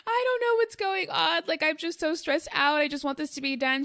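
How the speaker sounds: high, strained mock-distress; replaying a teary phone call, lightly; stressed caller phoning her astrologer friend, half-crying; retold crying. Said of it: "I'm calling her on the phone crying".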